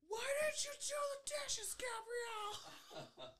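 very high, drawn-out mocking dad; squeaky dad calling daughter's name. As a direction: stretch the name out like a cartoon dad. Dad's voice at the door.

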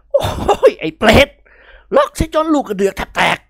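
gasping, livid scolding; breathless cursing son-in-law; furious old man. A narrator's reading. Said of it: "อย่างเดือดดาล".

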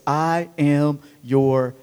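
slow soft I am your joy; tender, slow. Shown as a demonstration. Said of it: "you would say to yourself is".

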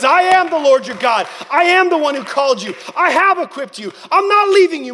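loud, high, forceful proclamation; God's declaration. Acted. God answering a believer's reminder, booming declaration. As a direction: declare it at full voice, rising in intensity. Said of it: "And he says".

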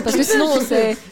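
parle à travers un rire; lâche la phrase en riant franchement. Real, not acted. Rit pendant le conseil. (en riant)

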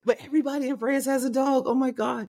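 high, fast burst of delight; excited. Real, not acted. Telling the host about France, excited and high. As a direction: gush about all the French dogs.

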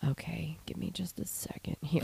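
whispered, soft medium asking for a moment; breathy hushed psychic voice. Retold or imitated. Medium pausing to concentrate.

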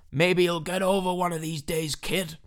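hammed-up boss impersonation; Vince McMahon. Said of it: "that's bad Vince McMahon accent".

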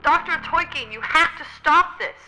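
distant yelling. Acted. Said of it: "someone is yelling".

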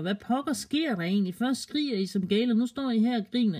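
lightly voiced baffled boy; puzzled brother. A narrator's reading.

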